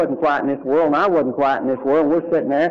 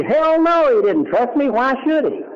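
emphatic exclamation; 8 semitones higher than usual. Real, not acted. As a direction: throw it out with disbelief. Emphatic retort.

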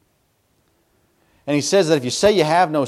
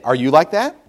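ordinary talk, nothing marked

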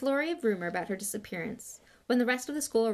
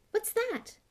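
high, surprised query; pitched-up puzzled question; curious question. A narrator's reading. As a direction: ask with sudden curiosity. Harry spots something, asks.

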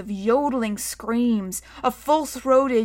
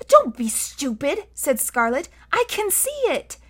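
put-on scolding girl; 6 semitones higher than usual. A narrator's reading. Girl scolds boy in story.

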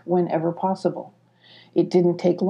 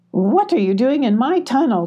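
raised-pitch, indignant spider queen; demanding queen; 6 semitones higher than usual. A narrator's reading. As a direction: demand answers as an affronted ruler. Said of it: "demanded the queen spider".

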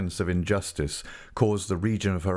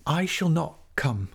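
higher, defiant outcry, quickening; crying out; 8 semitones higher than usual. A narrator's reading. Tess refuses d'Urberville's house, crying out defiantly. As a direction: fling the refusal back at him. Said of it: "she cried".

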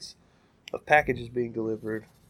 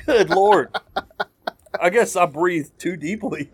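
loud, high laughing fit; 7 semitones higher, 8 dB louder, slower than usual. Real, not acted. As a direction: laugh through the words after inhaling tobacco. Snuffed tobacco, laughing fit.

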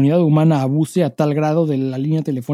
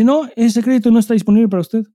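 mock agent refusal; 5 semitones higher than usual. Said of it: "me dice".

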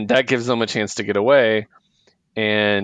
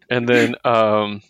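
ordinary talk, nothing marked

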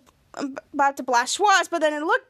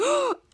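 sharp gasp; 10 semitones higher than usual. Retold or imitated. She sees the computer beeping.

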